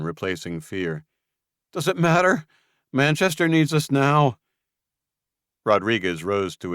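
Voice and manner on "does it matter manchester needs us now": high, loud impatient dodge; Julian deflecting. Acted. Julian dodging Luke's question, high and impatient. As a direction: brush the question aside.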